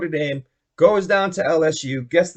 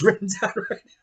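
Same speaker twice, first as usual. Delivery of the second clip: normal talking voice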